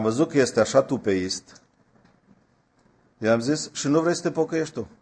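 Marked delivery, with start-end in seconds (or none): 3.7-4.9 higher and fast, a challenging comeback; the preacher turns the question on the cheeky youth, quick and challenging; quick retort. Retold or imitated. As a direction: fire the question back at him.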